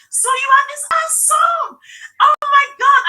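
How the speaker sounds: high, loud, tearful cry of amazement; shrieking through sobs of disbelief; overjoyed crying scream. Retold or imitated. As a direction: relive the moment you burst into tears of joy. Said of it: "I was screaming and crying".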